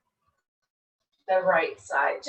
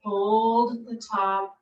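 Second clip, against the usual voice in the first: soft spa whisper; 6 dB quieter than usual. Acted. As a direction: ease them into stillness, unhurried. Guided relaxation in yoga pose.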